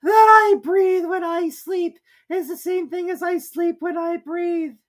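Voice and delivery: very high, slow, drowsy squeak; sleepy Dormouse. A narrator's reading. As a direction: drone it half asleep. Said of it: "seemed to be talking in its sleep".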